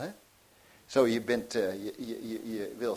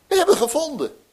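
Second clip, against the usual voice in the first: much higher and louder, bursting with joy; excited announcement; 14 dB louder, faster than usual. Shown as a demonstration. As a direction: shout the news of the find. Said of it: "als je dat nou zegt". ("We hebben gevonden" jubelend, veel hoger en luider uitgeroepen)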